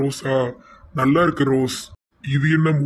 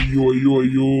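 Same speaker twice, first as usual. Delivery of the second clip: mild acted wail of grief; crying out; slower than usual. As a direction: moan ஐயோ over and over in sorrow. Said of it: "முட்டி முட்டி அழுதாரு".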